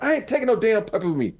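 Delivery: high, indignant refusal; indignant retort. Retold or imitated. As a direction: squeak it out in outrage. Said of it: "I'm like".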